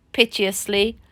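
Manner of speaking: ordinary delivery, nothing marked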